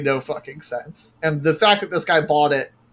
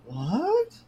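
baffled what; 8 semitones higher, 6 dB quieter than usual. Retold or imitated. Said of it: "And you're like".

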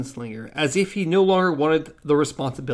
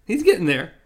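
ordinary talk

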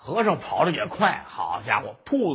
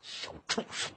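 whispered, much softer curse; mother spitting an insult at her son; contemptuous scold; 14 dB quieter than usual. Acted. Mother scolds son after slap. (低声狠骂)

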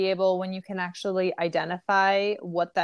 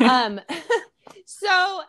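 laughing; 9 semitones higher, 4 dB louder than usual. Real, not acted. Laughs at own joke.

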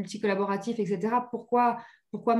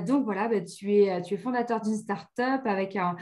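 usual speaking voice

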